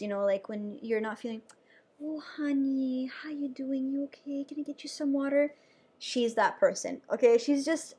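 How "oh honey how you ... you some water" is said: a hint of a soft, caring nurse asking after a patient; lightly voiced gentle nurse, easy and kind. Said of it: "She's one of those nurses".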